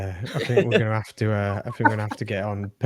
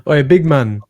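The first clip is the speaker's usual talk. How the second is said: loud, higher London roadman call; put-on roadman accent, hailing someone; 5 semitones higher, 10 dB louder than usual. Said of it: "You are a roadman".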